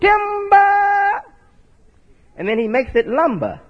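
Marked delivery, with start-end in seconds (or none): 0.0-1.2 high, long drawn-out holler; ringing, held-out high cry; lumberjack's timber call. Acted. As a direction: call it out high and sustained like a warning.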